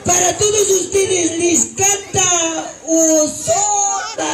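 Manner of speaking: anuncio agudo que acaba alargado a gritos; presentador de concierto. Acted. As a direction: presenta al artista estirando el nombre. Se presenta como cantante al público. (animador de show, a voz en alto)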